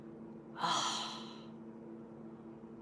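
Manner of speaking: a long breathy sigh under the breath; whispered sigh. Real, not acted. Streamer sighing softly to her viewers.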